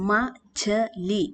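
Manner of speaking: മച്ച്ലി drawn out very slowly, short a, no long vowels; slow careful machli. Shown as a demonstration. Teacher to students, slow careful model word. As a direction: spell out മച്ച്ലി syllable by syllable. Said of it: "മാച്ചാലി എന്ന് വായിക്കരുത്".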